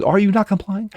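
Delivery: mock officer demand. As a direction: play the officer pressing him. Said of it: "he goes".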